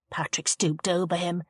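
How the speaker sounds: ordinary delivery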